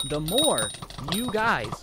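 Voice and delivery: slow, deliberate, word by word; very slowly. Shown as a demonstration. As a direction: spell it out slowly with pauses between phrases. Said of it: "let me say it very, very slowly".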